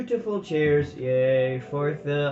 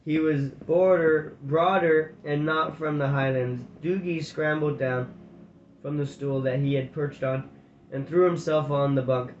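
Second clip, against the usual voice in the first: ordinary delivery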